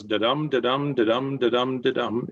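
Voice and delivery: da-dum with stress on each DUM; sing-song da-DUM pulse, second syllable stressed; iambic meter. Shown as a demonstration. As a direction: beat out da-DUM evenly, five times. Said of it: "the meter, it goes".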